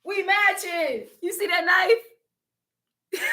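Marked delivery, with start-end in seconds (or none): 1.2-3.3 squeaky high cry-laugh breaking through; laughing helplessly. Real, not acted. Noticing the matching knife, laughing uncontrollably. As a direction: crack up so hard it turns to squeals.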